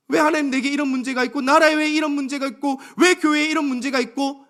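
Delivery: high, insistent, piled-up questions; complaint to God. Acted. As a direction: press God with one why after another. (높은 목소리로 하나님께 따져 묻는 탄식)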